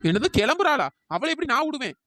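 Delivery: ordinary delivery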